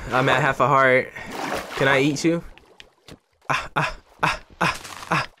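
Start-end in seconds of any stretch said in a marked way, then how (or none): 3.5-5.3 panicked. Real, not acted. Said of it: "Okay, calm down".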